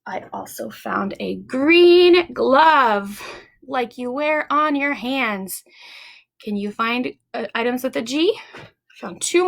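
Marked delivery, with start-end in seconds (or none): 0.1-3.2 slow, deliberate show-and-tell; slow teacher reveal. Shown as a demonstration. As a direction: draw it out for the kids.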